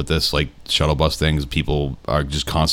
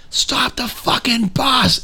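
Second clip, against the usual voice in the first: high, slow, drawn-out mock rant; imitating furious bus lady; much slower than usual. Retelling the bus lady yelling at the chaperone, high slow mock voice. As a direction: stretch each word up high like an unhinged stranger.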